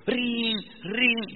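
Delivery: doorbell imitation.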